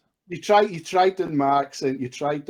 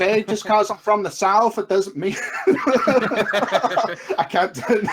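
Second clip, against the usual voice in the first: higher, slow Texan drawl breaking into laughter; Texas accent; 7 semitones higher, slower than usual. Acted. Scottish caller playing a Southerner for laughs, Texas accent. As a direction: put on a Texas accent and crack up. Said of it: "a Scotsman can do a Texas accent".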